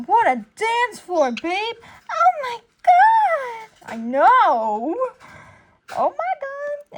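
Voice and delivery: put-on high, squealing girlfriend voice; girlfriend gushing. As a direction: gush like an excited girlfriend. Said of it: "That's why he's like".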